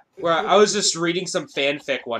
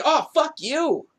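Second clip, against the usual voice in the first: slow, high fed-up scoff; mock outrage at the copyright claim; exasperated retort; 10 semitones higher, slower than usual. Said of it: "I'm like".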